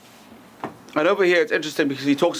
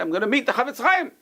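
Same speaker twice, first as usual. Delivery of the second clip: higher, eager excitement; excited; 8 semitones higher than usual. Retold or imitated. Jumpy traveler telling the Havetz Chaim he's excited. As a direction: sound thrilled to meet him. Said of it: "very excited".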